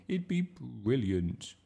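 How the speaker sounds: slow, high, eager plea; begging. A narrator's reading. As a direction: coax teacher with drawn-out enthusiasm. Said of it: "begged Bertie".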